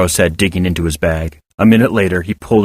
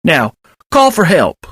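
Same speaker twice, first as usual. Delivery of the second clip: slow, steadying voice; composing himself slowly; holding back emotion; 8 semitones higher, slower than usual. A narrator's reading. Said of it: "regain control of his emotions".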